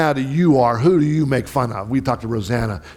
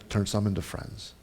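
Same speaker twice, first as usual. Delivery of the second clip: normal talking voice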